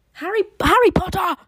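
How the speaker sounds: loud, high, hammed-up character cry; film character impression. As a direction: shout it out as an over-the-top impression. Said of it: "I'm not gonna do my impressions".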